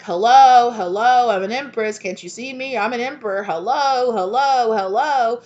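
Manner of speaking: loud, high calling out to be noticed; mock pleading call. You chasing them, calling out loud and high. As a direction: wave for attention, a little desperate. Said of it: "being like".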